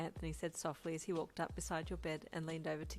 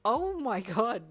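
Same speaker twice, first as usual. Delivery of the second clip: much louder, slow, laughing disbelief; 5 semitones higher, 11 dB louder, much slower than usual. A narrator's reading. New mum seeing the rainbow blanket, laughing. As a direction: laugh out in amazement. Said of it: "you said with a laugh".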